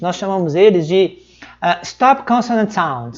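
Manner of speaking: ordinary delivery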